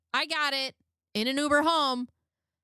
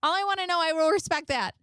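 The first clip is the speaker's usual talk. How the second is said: higher, pleading mock read; mock needy girlfriend; 7 semitones higher, 3 dB louder, faster than usual. [name] begging Michael for reassurance in a higher mock plea. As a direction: act out the clingy text.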